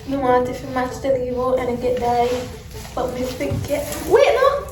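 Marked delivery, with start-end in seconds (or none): 4.1-4.7 sudden high yelp of surprise; surprised. Real, not acted. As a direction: blurt it out, startled and high.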